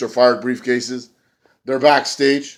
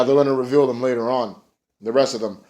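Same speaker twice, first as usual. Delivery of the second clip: normal talking voice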